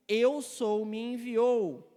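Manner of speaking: slow, loud scripture reading; emphatic proclamation. Acted. Preacher reads God's message for the Israelites, slow and emphatic. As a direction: proclaim it firmly, word by word.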